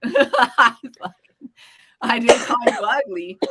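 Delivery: slow, laughing repeat of her own line; amused exasperated repeat. Real, not acted. Laughing retelling own retort.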